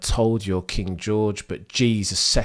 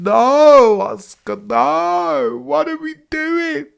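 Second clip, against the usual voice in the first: despairing cry; 11 dB louder, much slower than usual. Real, not acted. Piastri crash, despair.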